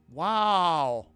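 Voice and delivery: ordinary talk, nothing marked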